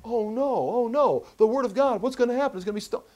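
put-on high-pitched dismay; mock panic. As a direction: wail it like a helpless worrier, hammed up. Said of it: "it's like".

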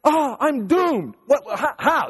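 high, slow, hammed-up doom; mock despair. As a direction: drag it out like a whiny prophet. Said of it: "He's like".

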